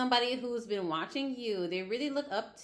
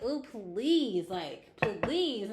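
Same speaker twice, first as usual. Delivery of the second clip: drawn-out mock plea, louder; exasperated pleading; 2 semitones higher, 5 dB louder, much slower than usual. Reader imitating a client tired of a clingy person, mock pleading. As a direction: beg them to stop, playfully fed up. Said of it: "you're just like".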